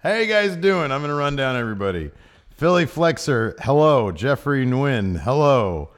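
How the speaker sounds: loud, booming roll call; announcer. Acted. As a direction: call out every name like an emcee.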